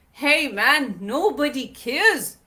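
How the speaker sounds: ordinary talk, nothing marked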